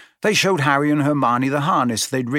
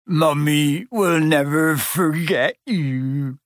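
very slow, choked, tearful; a big man crying over his baby; tearful goodbye; 3 semitones higher, much slower than usual. A narrator's reading. Hagrid bidding his dragon farewell, very slow and tearful. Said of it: "Hagrid sobbed".

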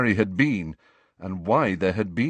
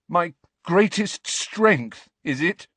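slow, higher and louder bitter retort; voice shaking; 8 semitones higher, 3 dB louder, slower than usual. A narrator's reading. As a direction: throw the words back, trembling with rage. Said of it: "his voice shaking".

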